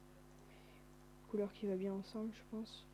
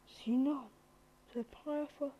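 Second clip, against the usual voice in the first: excited amazement; 4 semitones higher, 4 dB louder than usual. Real, not acted. Render progress passes old record.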